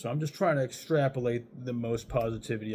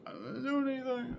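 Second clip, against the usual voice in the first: meek surrender. Retold or imitated. Hands up to the cops.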